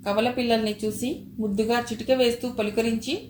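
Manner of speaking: usual speaking voice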